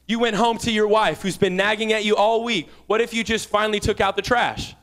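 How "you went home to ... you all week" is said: ordinary delivery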